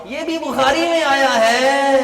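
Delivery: high, stretched preaching call; ringing proclamation. Real, not acted. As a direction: lift the pitch and hold the last word. (اونچی، لمبی خطیبانہ پکار)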